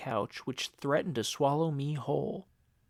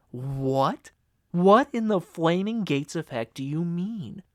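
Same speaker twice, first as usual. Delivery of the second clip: louder, demanding disbelief; shocked outrage; 4 semitones higher, 8 dB louder than usual. A narrator's reading. Jason confronting Uncle Jed about parents, louder shocked outrage. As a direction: snap back in shocked anger.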